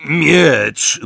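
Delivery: loud, gruff grunt of an answer; grunted reply. A narrator's reading. Snatcher answers her about the sword, grunting. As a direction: grunt it back curtly and loudly. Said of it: "burknął niewidoczny dla niego szmalcownik".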